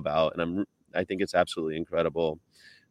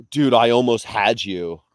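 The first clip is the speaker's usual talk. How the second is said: put-on loud, slow Californian accent; Paul Walker; 3 semitones higher, 6 dB louder than usual. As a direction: stretch it out like a California dude, louder. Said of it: "the, the American Californian accent".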